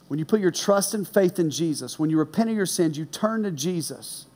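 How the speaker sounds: usual speaking voice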